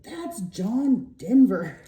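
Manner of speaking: slow, deadpan correction, each word landed; flat disbelief. Retold or imitated. Husband correcting her movie mix-up, slow deadpan disbelief. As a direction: stare at her, then spell it out slowly.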